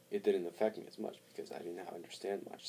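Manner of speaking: usual speaking voice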